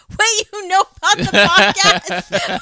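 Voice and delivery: high, loud, slow disbelieving question; shocked disbelief. Retold or imitated. Daughter realising mom knows her podcast, shocked squeal. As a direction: squeal it in horrified surprise. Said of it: "I was like".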